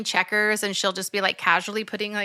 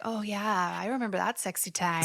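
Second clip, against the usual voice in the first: hushed, lower mock-sensual purr; 3 semitones lower, 8 dB quieter than usual. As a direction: play it as a softly sultry memory. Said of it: "being sensual".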